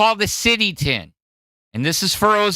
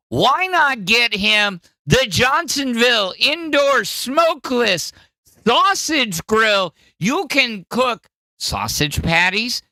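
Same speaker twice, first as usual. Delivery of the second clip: infomercial pitch; 6 semitones higher than usual. Acted. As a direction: sell it like a late-night ad. Mock ad pitch for gift.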